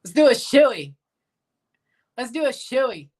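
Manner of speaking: jokey Australian impression; fake Australian accent. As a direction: put on a playful Australian voice. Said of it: "A fake Australian accent".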